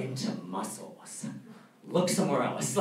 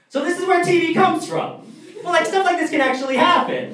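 stunned realization; 14 semitones higher, 7 dB louder than usual. Retold or imitated. Everyone stares in the square.

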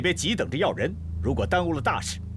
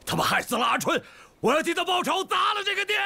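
rising, high furious cry; vengeful outburst; furious vow; 12 semitones higher than usual. Acted. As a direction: rage at the brothel. Vowing revenge, held back.

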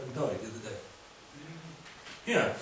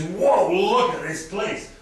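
awed exclamation; 7 semitones higher, 11 dB louder, much slower than usual. Acted. Sheep voiced in awe.

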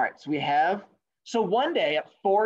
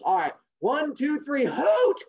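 owl race starter; 9 semitones higher, 4 dB louder, slower than usual. Acted. Owl starts the race, rising count into a hoot. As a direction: count climbing to a high, loud hoot.

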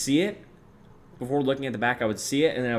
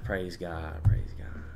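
soft, low throwaway imitation, fading; Theo Vaughn catchphrase; 5 semitones lower, 4 dB quieter, slower than usual. Streamer quoting a podcaster's catchphrase, softly imitated. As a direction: lightly imitate a pious aside, quietly. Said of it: "And then he'll say something".

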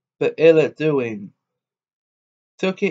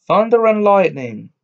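cried out; 5 semitones higher, 4 dB louder than usual. A narrator's reading. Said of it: "cried the tailor".

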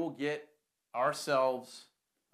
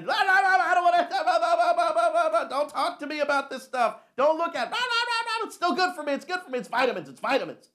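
high, loud whining brat voice with laughs; mock a stubborn kid refusing his vitamins; whiny child refusing; 13 dB louder than usual.

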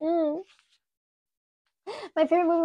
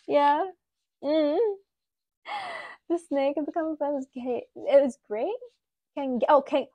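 ordinary talk

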